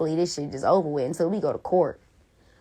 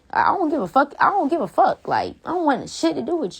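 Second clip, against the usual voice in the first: higher, sharper, real defiance; defiant outburst; 7 semitones higher than usual. Real, not acted. Ranting at her ex, defiant and fed up. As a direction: fling it out, done caring.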